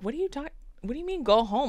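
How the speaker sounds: quick, high, baffled; confused disbelief. Retold or imitated. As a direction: rattle it off confused. Confused drunk self quoted.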